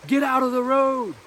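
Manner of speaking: put-on sharp, high warning yell to a child in the road; sudden loud scream of warning; screaming at kids. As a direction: bark it out like a frightened parent. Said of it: "I scream at them".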